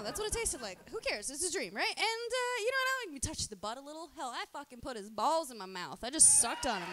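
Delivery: ordinary delivery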